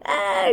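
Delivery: high, sharp "aah" of a scream, imitated; retold scream. Girl reliving her hair-pulling fright with a high imitated scream. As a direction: recreate the scream she gave in the dark. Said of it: "करके चिल्लाया".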